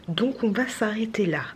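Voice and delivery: whispering. Real, not acted. Late night, baby asleep.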